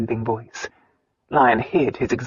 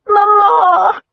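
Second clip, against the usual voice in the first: high, loud wailing cry; 8 dB louder than usual. A narrator's reading. Sister wailing for her mother, loud and high. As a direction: wail it out, heartbroken. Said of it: "Diana wailed".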